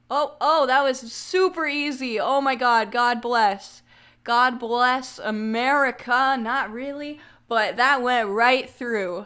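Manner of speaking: loud, thrilled outburst of relief; excited. Real, not acted. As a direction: shout it, overjoyed it worked. Excited, the stretch went through easily.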